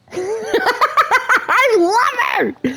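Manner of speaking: high squeal through laughter; real, high, stretched-out laughing exclamation; laughing delight. Real, not acted. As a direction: burst out laughing while gushing. Laughs fondly at Rapunzel's line.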